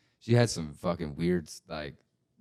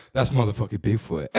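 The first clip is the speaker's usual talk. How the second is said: retold exclamation; 4 semitones higher, 9 dB louder than usual. Retold kid's conclusion about shadow.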